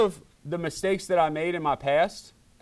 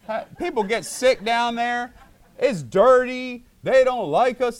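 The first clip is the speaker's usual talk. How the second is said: mock alarm; 7 semitones higher, 6 dB louder than usual. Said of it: "Have you never heard".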